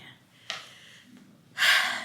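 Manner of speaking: long, noisy sigh. Real, not acted. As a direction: let out a heavy breath. Pause while whispering in library.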